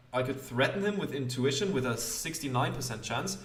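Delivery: ordinary delivery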